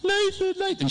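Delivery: high, tearful mock calling of his name; mother in tears calling her son on the phone; retold crying mom. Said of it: "She's crying".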